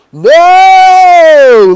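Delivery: long, high, full-force scream of denial; panicked yell. Retold or imitated. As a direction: relive the horror at full volume. Retold panic at house fire.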